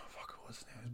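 whispering to himself, trying to recall a name; whispered aside. Real, not acted. Veteran searching his memory for a fallen friend's name, whispered. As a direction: mutter it under your breath like a private aside.

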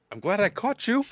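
high, loud, hurrying cheer; cheerful voice. Acted. Young man rousing the guests at their door, high and cheerful. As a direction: chivvy them out of bed brightly. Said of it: "comes a cheerful voice".

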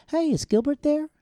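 high bright mock caller on the phone; mock phone caller, pitched high. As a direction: pitch it up like a polite caller asking for Gilbert. Phone call voiced in story.

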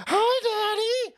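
put-on slow high little boy voice; sweet whiny kid greeting daddy; childish voice. Voicing Hunter on phone.

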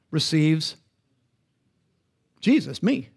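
"receives" set apart, then "Jesus, me" with a rise on "Jesus"; pause, then land "Jesus, me" as the point; pronounced, with pauses. Shown as a demonstration. Preacher driving home the verse to the congregation, slow and pointed. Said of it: "I wanted to make it pronounced".

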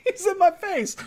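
high, defensive whine; high Brian voice. Retold or imitated. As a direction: raise the pitch, protesting. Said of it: "Brian gets pushed away and he goes".

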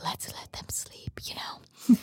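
soft whisper so they stay asleep. Retold or imitated. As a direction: hush it, don't wake them. Letting sleepers rest.

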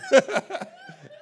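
high, loud burst of laughter. Real, not acted. Laughing at own story.

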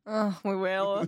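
slow, cheeky, suggestive tone; naughty. Pretend. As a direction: drawl it out naughtily. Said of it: "don't sound so naughty".

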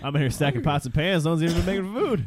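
high, loud exasperated rant; annoyed cook voice. Retold or imitated. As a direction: complain about doing all the work. Alice voiced complaining.

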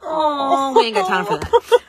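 high, loud laughing rush; mock hurry. Mom rushing slow toddler with socks, loud laughing. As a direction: brush her off, no time. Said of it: "I was like".

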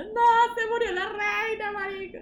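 high wailing, slow and loud; mock crying. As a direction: wail it like a hammed-up mourner. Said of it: "la bicha llorando".

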